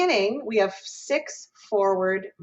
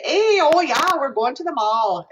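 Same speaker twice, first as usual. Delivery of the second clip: put-on bright, loud Minnesota accent, pitched up high; 8 semitones higher, 6 dB louder than usual. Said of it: "if you're from Minnesota, like me, and you say".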